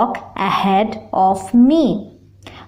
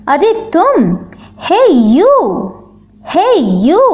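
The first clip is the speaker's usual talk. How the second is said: higher-pitched hailing call; 5 semitones higher, much faster than usual. Shown as a demonstration. Teacher demonstrating how to hail someone, calling out. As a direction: get someone's attention with a lilting, rising call.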